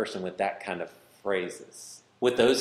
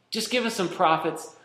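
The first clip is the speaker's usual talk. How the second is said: mock pleading; 8 semitones higher than usual. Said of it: "so much easier to just go back".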